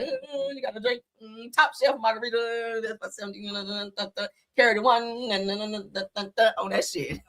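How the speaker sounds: put-on distracted muttering over the bill; mock bill calculating. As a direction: hum and stammer through pretend sums. Acts out adding up the bill.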